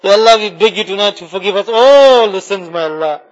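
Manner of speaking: usual speaking voice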